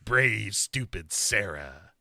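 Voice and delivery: slow, sinking villain taunt; creepy phone caller. Retold or imitated. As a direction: draw it out as a menacing caller. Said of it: "the voice says".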